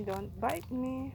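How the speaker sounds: ordinary talk, nothing marked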